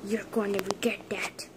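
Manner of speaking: whispered threat; low hissed warning; quiet pretend menace; menacing whisper. Villain threat in fight.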